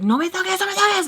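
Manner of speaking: high-pitched frantic warning; retold freak-out. Imitating herself as he reaches for her phone, freaking out. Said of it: "I'm, like, freaking out".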